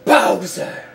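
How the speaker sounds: slow, loud menacing challenge; hammed-up declaration to the villain; dramatic hero challenge. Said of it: "I just love doing voicing".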